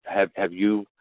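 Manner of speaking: ordinary talk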